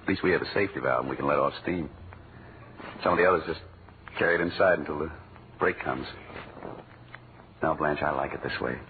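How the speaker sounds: low, soft, sincere confession; tender sincere. Acted. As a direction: speak softly from the heart.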